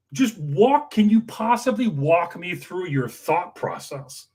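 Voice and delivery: incredulous slow scolding; sarcastic retold lecture. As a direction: spell it out with scorn. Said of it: "part I said to him was".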